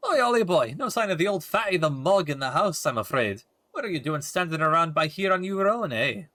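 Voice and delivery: broad Welsh lilt, chatty and brash; Welsh fairy sidekick. Acted. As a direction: play the Welsh fairy sidekick with some swagger. Voices Drippy in game.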